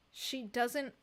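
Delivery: ordinary talk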